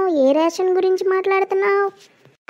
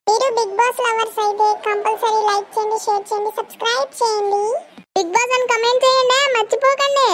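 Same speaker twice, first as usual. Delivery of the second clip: outro appeal; 5 semitones higher than usual. Acted. Outro call to viewers.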